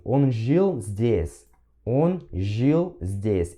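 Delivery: very slow careful Russian; very slow demo. Shown as a demonstration. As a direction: drag each word slowly. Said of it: "E aí ele fala assim". (leitura muito lenta)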